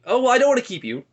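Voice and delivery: hammed-up attempt to bow out; acted polite excuse.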